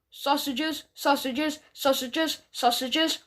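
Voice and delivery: softly growled, repeated dog talk; low growl. A narrator's reading. Dog sniffing at the tortoises, low growl. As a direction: growl it under your breath like a dog. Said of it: "he went in a low growl".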